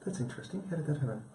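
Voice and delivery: hushed, curious self-talk; mildly puzzled. Retold or imitated. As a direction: wonder it quietly to yourself. Acts out his own puzzled reaction.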